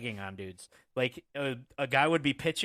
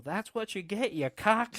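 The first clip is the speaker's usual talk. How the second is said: higher mock jeer; heckling the ump; 8 semitones higher, 3 dB louder than usual. Heckler taunting the umpire, mock yell. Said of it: "and I go".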